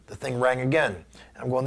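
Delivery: ordinary talk, nothing marked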